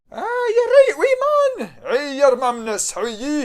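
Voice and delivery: high, loud broad Scottish mother; broad Scottish accent. Acted. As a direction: play a doting Scottish mum, high and loud.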